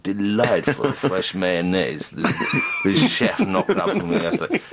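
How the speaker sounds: slow, laughing, lordly room-service order; pampered hotel guest. Retold or imitated. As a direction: play a spoilt star ordering lobster. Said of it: "pick up the phone and go".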